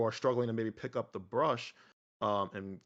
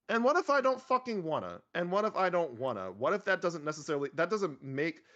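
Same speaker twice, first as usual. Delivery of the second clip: high-pitched, protesting comeback; defiant retort; 6 semitones higher than usual. Acted. Painter answering "push your highlights" feedback, defiant retort. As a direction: push back in mock indignation, pitch starting high.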